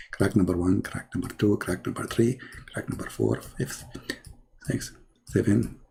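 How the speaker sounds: low, soft count to himself; muttered counting. Pretend. Man counting cracks as he draws, muttered counting.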